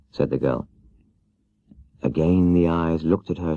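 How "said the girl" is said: usual speaking voice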